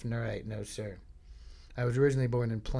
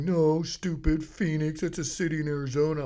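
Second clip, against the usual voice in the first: scornful correction; 4 semitones higher, 5 dB louder than usual. Retold or imitated. Brother correcting his twin, higher scornful voice. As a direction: correct a gullible sibling with mock scorn.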